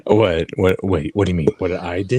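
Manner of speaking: low ASMR murmur, feigning innocence; mock ASMR, low and intimate; playful ASMR. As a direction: play innocent in a close-mic ASMR voice. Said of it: "How did you make your microphone".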